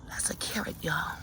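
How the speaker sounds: whispered reveal of the carrot; soft whispered aside to viewers. Real, not acted. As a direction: hush it like sharing a secret.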